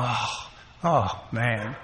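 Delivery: soft, deflated oh man; dismayed sigh. Retold or imitated. Reading the prison sign, quiet deflated dismay.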